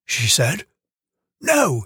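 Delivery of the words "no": sharp No, much higher; a quick angry snap at a child; snapped. Acted. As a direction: cut her off sharply. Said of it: "he snapped".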